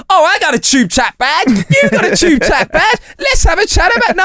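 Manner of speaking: high, whiny mock of a chatty stranger; mocking annoying chatterer. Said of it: "some fucking twat going".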